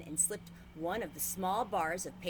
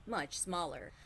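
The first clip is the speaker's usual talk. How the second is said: plain reading voice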